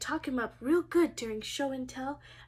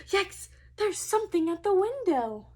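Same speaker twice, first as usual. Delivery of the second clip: startled; 4 semitones higher, 6 dB louder than usual. Acted. Child frightened by noise.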